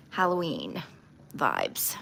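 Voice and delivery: ordinary talk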